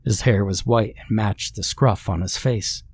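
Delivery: ordinary delivery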